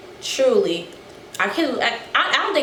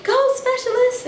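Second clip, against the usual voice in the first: much higher cheering call; a light imitation of a private's cheer; girl cheering. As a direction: shout encouragement to a passing runner. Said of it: "scream for you".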